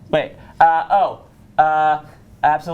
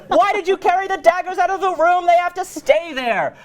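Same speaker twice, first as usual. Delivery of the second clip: high-pitched, hammed-up reproach; exasperated scolding; 12 semitones higher than usual. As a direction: scold him in a much higher voice. Lady Macbeth scolds her husband.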